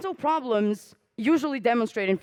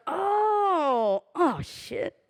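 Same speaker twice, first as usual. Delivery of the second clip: mock despair; 5 semitones higher, much slower than usual. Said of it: "I was like".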